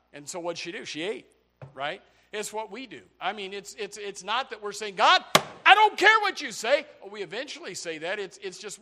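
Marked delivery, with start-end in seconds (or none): 4.9-6.9 loud, high outburst at God; defiant shout. Acted. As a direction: yell it in acted defiance.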